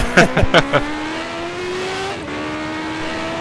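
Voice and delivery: embarrassed chuckle; laughing. Real, not acted. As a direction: laugh off the mistake. Laughs at his own mistake.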